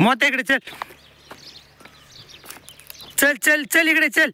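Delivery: very high call to a dog. Real, not acted. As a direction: call Motya back from far off. Calling the dog Motya.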